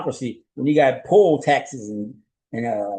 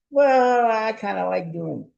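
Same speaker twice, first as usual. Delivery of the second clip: much higher, slow, sly mock voice of someone who won't stop; cheeky wrongdoer; 8 semitones higher, much slower than usual. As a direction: play the unrepentant crook, drawn out and sly.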